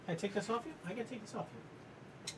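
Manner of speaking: soft hushed whisper to calm the dog. Real, not acted. To the dog while removing his gear, a hushed whisper. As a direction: breathe it, barely voiced.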